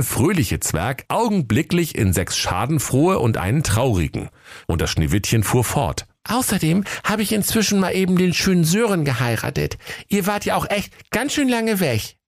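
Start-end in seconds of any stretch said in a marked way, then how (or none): 6.3-12.2 high, quick princess voice. Acted. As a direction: break the news lightly, high and brisk.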